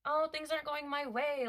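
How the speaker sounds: pitched high, sulky mock complaint; mock tantrum whine. Reader imitating a tantrum for viewers, high mock whine. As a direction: whine like a toddler not getting their way. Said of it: "feeling kind of like a temper tantrum".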